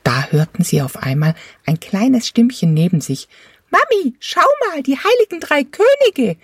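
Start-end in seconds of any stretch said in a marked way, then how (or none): 3.7-6.4 put-on very high little kid calling out; excited small child. A narrator's reading. Small child calling to mother, excited. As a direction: squeal it like a toddler spotting kings. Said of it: "rief ein Kind aufgeregt".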